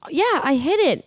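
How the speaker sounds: high, placating reply; high-pitched defensive mom; imitated mother. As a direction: answer the son in a high voice. Imitating cowed mother answering son.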